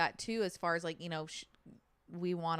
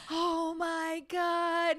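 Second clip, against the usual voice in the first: high, very slow drawn-out wail; mock scream; 9 semitones higher, much slower than usual. Retelling a bungee jumper finally letting go, mock scream. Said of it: "he is screaming".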